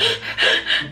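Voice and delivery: sharp voiceless gasps for air; mock hyperventilating gasp; imitated gasping. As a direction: act out struggling for breath. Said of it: "Now I'm hyperventilating".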